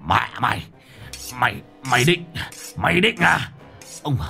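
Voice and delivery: stuttering, building fury; hissing with rage. A narrator's reading. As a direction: spit the words through clenched teeth. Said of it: "Ông rít lên". (rít lên giận dữ)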